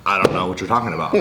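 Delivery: low, quick deadpan lie, retold; stone-cold denial. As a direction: deny it coolly like a caught kid. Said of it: "Stone cold".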